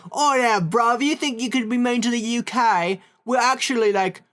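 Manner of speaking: hammed-up British bloke, cheeky; mock British accent. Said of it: "And they're like".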